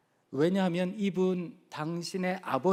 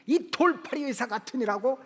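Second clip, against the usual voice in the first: 가볍게 흉내 낸 화; 5 semitones higher than usual. Retold or imitated. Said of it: "갑자기 이분이 화가 확 나시더래요".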